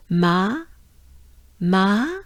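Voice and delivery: "Ma" held long with open A and rising end; drawn-out "ma". Shown as a demonstration. Teacher goes together with learners, drawn-out "ma". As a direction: stretch the vowel of "ma" upward. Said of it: "let's go together".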